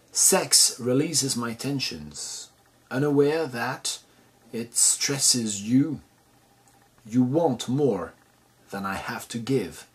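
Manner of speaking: slow, measured English poem reading; poem recitation. Acted. Translator reads the poem aloud before translating it, slow recitation. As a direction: read it line by line, slowly, with deliberate pauses.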